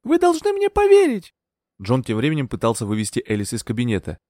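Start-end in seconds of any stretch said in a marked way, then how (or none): 0.0-1.3 very high, urgent plea; high-pitched, agitated insistence; desperate plea. A narrator's reading. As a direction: beg to be believed at a high pitch. Heroine desperate to be believed.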